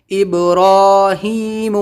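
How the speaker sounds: ابراہیم drawn out, recited tune; آہستہ تلاوت; slow recitation. Shown as a demonstration.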